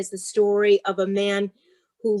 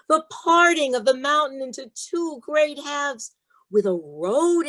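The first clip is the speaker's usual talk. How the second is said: put-on slow, expressive reading to children, pitch raised; story read-aloud; 3 semitones higher than usual. Author reading her book to a class, slow and expressive. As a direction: read the chapter to the class with feeling.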